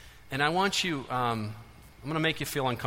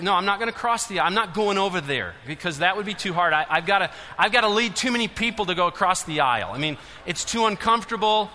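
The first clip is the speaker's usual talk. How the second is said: higher, louder whiny excuse-maker voice; mock stubborn churchgoer; 4 semitones higher, 6 dB louder than usual. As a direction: parody a member refusing to cross. Mimics reluctant churchgoer.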